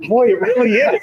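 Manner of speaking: laughing through the words. Real, not acted. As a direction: chuckle while speaking. Laughing at joke.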